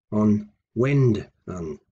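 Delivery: on WENdan, slowly pulled apart; slow down and dwell on wend; stress in middle. Shown as a demonstration. Teacher breaking up the word for to change, slow stress on wend. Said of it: "the stress there in the middle".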